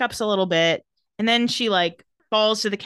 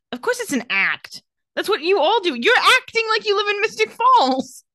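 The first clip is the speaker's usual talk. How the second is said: high, loud, scoffing voice; rising higher and louder as you mock them; mocking rant; 12 semitones higher, 4 dB louder than usual. Recapper scoffing at the vampires' act, high and loud. Mocking Damon's small-town jab.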